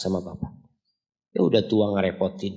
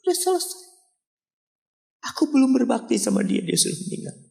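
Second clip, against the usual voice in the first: weeping scholar; 13 semitones higher than usual. Acted. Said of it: "ketika ibunya meninggal menangis".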